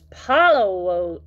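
pinched-nose disgusted complaint; rising, exaggerated stink complaint; holding his nose. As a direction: draw it out as if nose is held. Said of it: "holding his nose".

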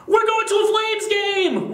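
high, loud excited shout; excited yell. A narrator's reading. Boy yelling to his friends about the game, high excited shout. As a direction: burst out with the big news. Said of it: "Liam yelled".

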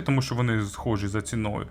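normal talking voice

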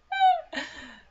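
sudden high laughing burst; shrieking laugh of disbelief; loud burst of laughter. Real, not acted. Laughs at her own reaction.

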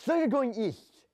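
a game master springing the big reveal, drawn out; sudden eager announcement. Retold or imitated. Colin the game master narrates.

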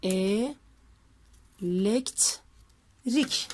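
"E, lekt, rik" split into syllables with pauses; sounding out words. Shown as a demonstration. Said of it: "nasıl ayırıyorum hecelerini".

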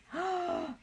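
sharp high gasp of dismay. Real, not acted. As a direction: catch your breath in shock. Finds gold ribbons retiring.

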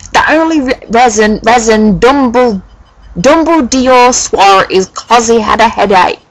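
high, emphatic, indignant rant; petulant author. Acted. Author scolding flamers in her note, high petulant rant.

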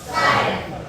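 쌀 forced out high and strained; forced ssal. Shown as a demonstration. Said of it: "억지로 하지 마시고요". (억지로 짜낸 높은 쌀)

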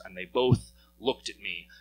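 usual speaking voice

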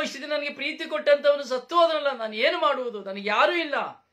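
loud, high lament over the dead; mourner's wailing. Retold or imitated. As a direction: wail for a lost loved one. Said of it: "ಕೂಗಾಟವನ್ನು ನಾವು ನೋಡುತ್ತೇವೆ". (ಸತ್ತವರಿಗಾಗಿ ಜೋರಾಗಿ ಗೋಳಾಡುವ ದುಃಖ)

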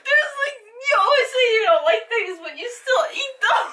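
laughing and squealing while tickled; high squealy laughing through words; being tickled. Real, not acted.

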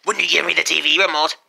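loud, fast outburst; sudden demand. Acted. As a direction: blurt it out as an urgent shout.

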